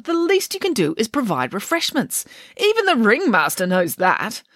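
scandalised by such rudeness; indignant Nanny. Acted. Nanny reassures Samantha.

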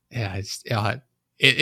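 normal talking voice

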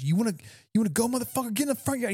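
high, fast mock challenge; dad waving a wrench, challenging his son to fight, high and rushed; imitated furious dad. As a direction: fire it off like an enraged father.

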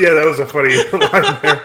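amused laugh breaking into the line. Real, not acted. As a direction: laugh through it as you agree.

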